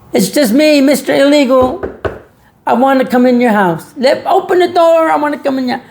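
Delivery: high, pleading voice at the door; mock illegal immigrant. Mock immigrant begging a homeowner to let him in, high and pleading. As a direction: play a sweet-talking stranger asking to come in. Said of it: "You get".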